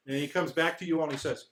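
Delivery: usual speaking voice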